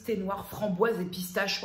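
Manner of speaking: ordinary talk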